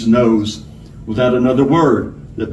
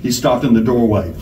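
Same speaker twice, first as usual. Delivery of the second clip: usual speaking voice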